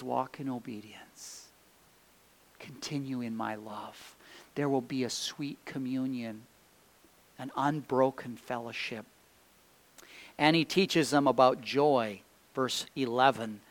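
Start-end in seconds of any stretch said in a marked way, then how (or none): 0.0-9.0 much softer, quiet and tender; hushed, comforting promise; soft reassuring. Acted. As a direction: answer gently after the big question. Preacher drops to gentle answer.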